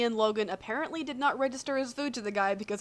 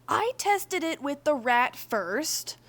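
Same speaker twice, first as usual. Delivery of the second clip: slow, pitched-up defensive quote; defensive Virgil; 5 semitones higher, 5 dB louder, much slower than usual. Acted. Virgil defending himself to Logan, slow and raised. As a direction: draw it out as if justifying yourself. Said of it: "Virgil had defended himself".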